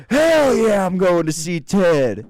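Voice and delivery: high, loud, slow whooping holler; yelling back. Retold or imitated. Worker yelling back across the factory, rowdy holler. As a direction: holler back with gusto, words stretched out. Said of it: "another guy yells back".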